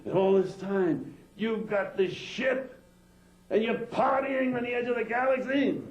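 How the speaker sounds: raging wife's rant. Acted. Wife berating the alien cosmonauts, high and furious. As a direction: lay into them at high pitch without a break.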